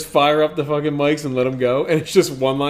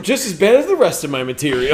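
ordinary delivery

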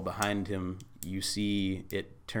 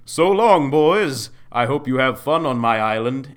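loud, high, gloating send-off; booming villain farewell; 5 semitones higher, 14 dB louder than usual. Acted. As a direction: boom out a triumphant goodbye. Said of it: "And he laughs says".

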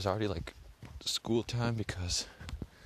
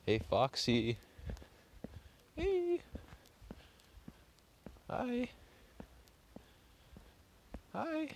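much higher sing-song call to a critter; coaxing animal call; 4 dB quieter, much slower than usual. Real, not acted. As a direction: coax it like calling a pet. Coaxing an unknown animal in the dark.

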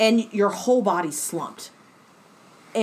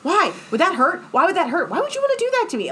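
high, pestering mock-innocent whys; toddler questions; 9 semitones higher, faster than usual. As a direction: imitate a little kid who won't stop asking. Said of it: "like, toddler questions".

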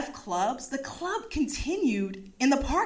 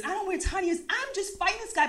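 usual speaking voice

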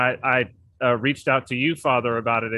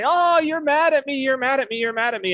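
high-pitched mock wail, easing down in pitch; imitated crying child; 10 semitones higher than usual. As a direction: imitate a small child's tearful complaint. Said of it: "She'll cry and say".

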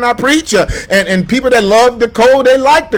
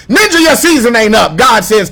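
preaching shout; 3 semitones higher, 3 dB louder than usual. Real, not acted. Preaching at full force.

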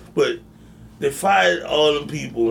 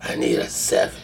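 gruff game voice. Pretend. Video game voice joke.

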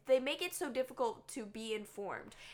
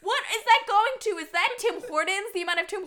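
laughing disbelief; 7 semitones higher, 10 dB louder than usual. Real, not acted.

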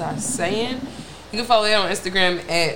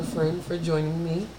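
ordinary delivery

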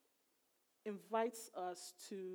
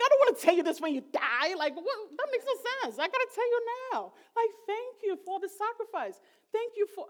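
overwhelmed thanks; 13 semitones higher, 14 dB louder than usual. Retold or imitated. Thanking parents, emotional retold call.

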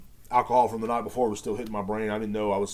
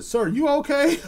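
high, louder startled question; alarmed check-in; 6 dB louder, slower than usual. Retold or imitated. Clerk stepping in for the customer, high and startled. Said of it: "I said".

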